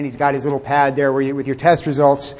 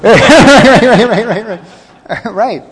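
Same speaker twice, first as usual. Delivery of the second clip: laughing; 6 semitones higher, 7 dB louder, much slower than usual. Real, not acted. Laughing at congregant's cigarette joke.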